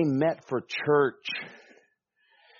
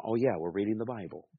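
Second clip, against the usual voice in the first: fast, softer snap back to attention; quick sheepish self-correction; catching yourself; 3 dB quieter, faster than usual. Retold or imitated. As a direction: mutter it quickly like you just remembered. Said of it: "your mind starts to go".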